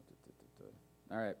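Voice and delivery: ordinary delivery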